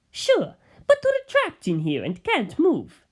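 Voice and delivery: Bucciolati's voice. Acted. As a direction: lift the pitch and take it slowly.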